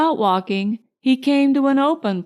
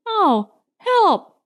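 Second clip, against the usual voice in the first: high yelp of alarm as he falls; a bear toppling from the branch cries out in sudden alarm; startled cry for help; 9 semitones higher than usual. A narrator's reading. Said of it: "said Pooh".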